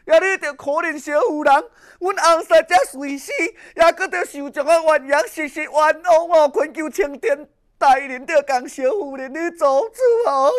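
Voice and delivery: a wailing widow's plea for justice; crying plea. Acted. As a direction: beg the judge through sobs. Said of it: "用个大声哭了".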